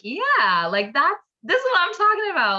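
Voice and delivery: high, loud and fast with delight; thrilled at the view; excited. Retold or imitated. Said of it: "I'm like".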